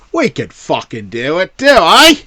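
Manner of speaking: bad Cockney British accent; hammed-up Cockney, pitch jumping up. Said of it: "That's a Cockney British accent".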